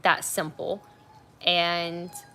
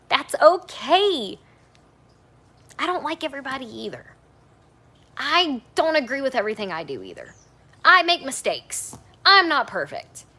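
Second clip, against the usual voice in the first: raised, slowed, insistent voice; louder for the back; 7 semitones higher, 8 dB louder than usual. Real, not acted. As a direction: drive each short sentence home loudly. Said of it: "a little louder for the people in the back".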